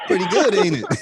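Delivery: gloating through laughter; laughing taunt. Retold or imitated. Laughing taunt in retold story.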